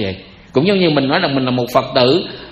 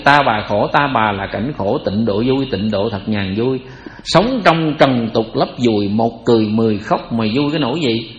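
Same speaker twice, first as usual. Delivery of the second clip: recited verse; 6 semitones lower than usual. Acted. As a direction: intone the lines like an old poem.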